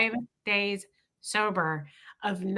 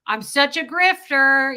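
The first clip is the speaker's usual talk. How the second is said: silly joke; 5 semitones higher, 6 dB louder, slower than usual. Retold or imitated. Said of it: "like, this silly joke form".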